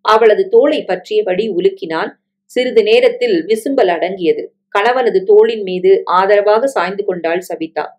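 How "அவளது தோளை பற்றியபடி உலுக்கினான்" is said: ordinary delivery, nothing marked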